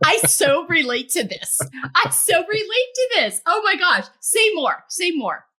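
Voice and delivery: high, loud burst of delighted recognition; excited agreement. Real, not acted. Host urging guest on after a relatable confession, excitedly. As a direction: gush eagerly, voice leaping up.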